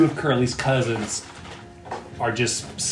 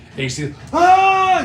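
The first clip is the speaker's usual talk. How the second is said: gasp; 6 dB louder than usual. Acted. DM describing skin melting.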